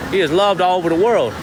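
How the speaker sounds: ordinary talk, nothing marked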